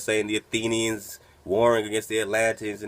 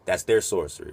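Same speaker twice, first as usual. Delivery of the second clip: ordinary delivery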